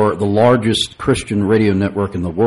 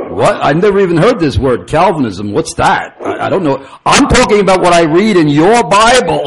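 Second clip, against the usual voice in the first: put-on much higher and louder, baffled Brooklyn accent; thick Brooklyn accent; 8 semitones higher, 5 dB louder than usual. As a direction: Ira puzzled out loud, Brooklyn accent thick. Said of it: "a very thick Brooklyn accent".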